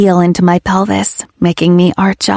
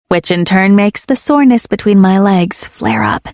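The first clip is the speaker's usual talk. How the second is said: plain reading voice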